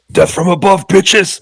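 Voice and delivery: high, loud action-hero yell; high-pitched, gleeful war cry; triumphant battle cry. Acted. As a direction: shout it while dropping in on the enemy. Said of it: "And he's like".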